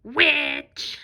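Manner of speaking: monster voice. Shown as a demonstration. Teacher reading sight words with kids, monster voice. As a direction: shout it like a monster. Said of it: "use our monster voice".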